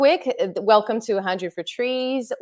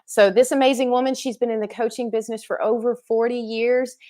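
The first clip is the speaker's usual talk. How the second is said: normal talking voice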